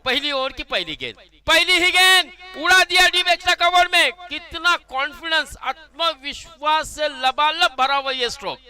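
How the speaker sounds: loud, high commentary burst; excited six call. Real, not acted. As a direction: yell out the big hit. Six off the first ball.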